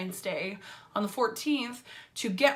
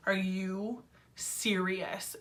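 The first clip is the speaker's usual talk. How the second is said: slow, softer, drawn-out disbelief; disbelieving inner voice; 2 semitones lower, 6 dB quieter, much slower than usual. Retold or imitated. Retelling her thought at the doctor's news, slow disbelief. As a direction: stretch it out quietly, stunned retelling. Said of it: "my mind was just like".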